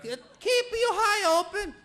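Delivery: very high, nagging mother's voice; Italian mom to her teen son, high and pleading. Retold or imitated. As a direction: whine it like an Italian mama pushing marriage. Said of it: "they tell you".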